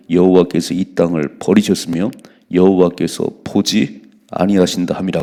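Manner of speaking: loud, slow, solemn reading; scripture reading. Acted. Preacher reading the verse loudly to his congregation. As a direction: proclaim the verse slowly and heavily.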